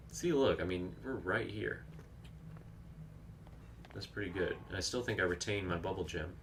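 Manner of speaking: ordinary delivery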